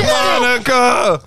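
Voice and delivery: long drawn-out yell of Monica!; retold startled shout, stretched slow; shouting a name. Retelling waking up, yelling for Monica. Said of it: "I was like".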